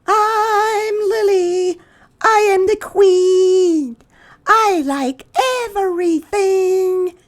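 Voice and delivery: put-on very high, slow, loud bossy girl voice; a new girl declaring herself queen, high and loud; Lily's voice. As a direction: announce yourself like a little queen.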